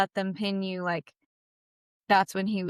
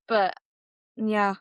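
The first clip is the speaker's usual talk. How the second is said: normal talking voice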